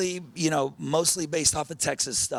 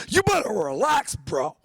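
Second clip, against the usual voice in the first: higher, mock catchphrase in a buddy's voice; imitating Ronnie; 8 semitones higher, 4 dB louder than usual. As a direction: tease your friend by doing his line. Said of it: "I would not ever try to steal Ronnie's impressions".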